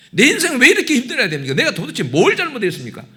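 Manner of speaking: high, loud retold complaint in prayer; protesting to God. Pastor re-enacts questioning God at the altar, loud impassioned protest. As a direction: plead and argue upward, re-enacting a tearful protest. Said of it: "막 하고 막 따졌어요".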